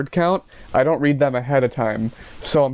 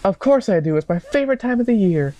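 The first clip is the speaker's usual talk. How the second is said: high-pitched, gushing reply; mock girlfriend voice; 8 semitones higher than usual. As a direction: gush in a high put-on voice. Voices girlfriend gushing about spring.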